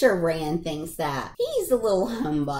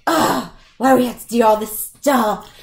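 put-on louder, groaning teenage gripe; whiny complaint; 3 semitones higher, 8 dB louder than usual.

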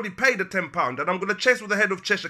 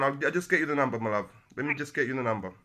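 calming down; 7 semitones lower, 5 dB quieter, faster than usual. Real, not acted. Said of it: "Let me calm down".

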